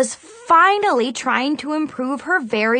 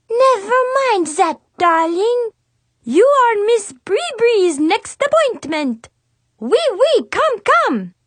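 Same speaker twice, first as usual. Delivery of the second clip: high, loud fake French accent of a little girl; a kid hamming up a French salon hostess; 10 semitones higher, 4 dB louder than usual. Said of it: "awful fake French accent".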